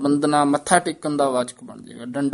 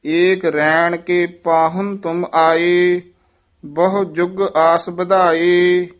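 measured chant with held line endings; scripture recitation; 3 semitones higher, 3 dB louder, much slower than usual. Acted. Teacher models Gurbani for students, measured chanted recitation. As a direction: recite evenly, pausing between phrases.

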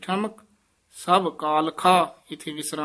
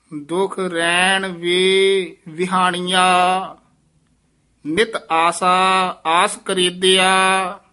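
slow, drawn-out devotional chant, louder; slow gurbani recitation; 5 dB louder, much slower than usual. Acted. Teacher reciting the verse to students, slow devotional chant. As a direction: intone each word slowly and reverently.

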